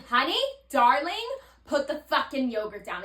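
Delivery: bold, blunt mock telling-off; yelled from the chest. Shown as a demonstration. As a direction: demonstrate a straight-up yell. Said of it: "Say it with your fucking chest".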